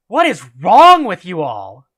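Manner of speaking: loud outburst, hard on "wrong"; fed-up outburst at the whole group; exploding in anger. A narrator's reading. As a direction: snap loudly at all of them. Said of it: "Clarice exploded".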